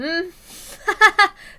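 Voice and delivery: high, loud embarrassed laugh; Tomitake's loud flustered laugh. Acted. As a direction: laugh it off in a panic. Flustered man caught out.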